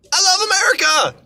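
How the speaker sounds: real emotional yell; patriotic shout. Real, not acted. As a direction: belt it out like a rally cheer. Patriotic climax of rant.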